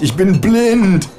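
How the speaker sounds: slow, very high, loud wail; blinded wail. A narrator's reading. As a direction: drag out the words in dramatic despair. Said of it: "schreie ich".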